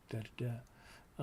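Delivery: usual speaking voice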